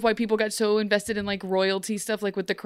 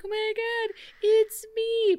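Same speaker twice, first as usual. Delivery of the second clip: thrilled squeal; 13 semitones higher, slower than usual. Retold or imitated. Regina thrilled she's the savior.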